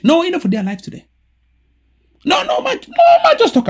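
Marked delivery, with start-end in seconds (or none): 2.2-3.7 high, loud laughing cry of no man; laughing protest, much higher and louder; excited protest. Real, not acted. Laughing at the host, cutting in loudly.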